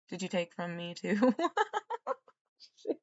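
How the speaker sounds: ordinary talk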